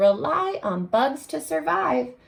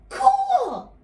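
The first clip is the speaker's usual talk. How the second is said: excited; 9 semitones higher, much slower than usual. Acted. Reader thrilled at dragonfly fact.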